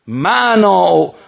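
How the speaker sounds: loud, high, drawn-out challenge; commanding shout. Retold or imitated. Imam shouting at his unruly soldier, a drawn-out commanding cry. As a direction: stretch the words into a ringing shout. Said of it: "سرش داد زد".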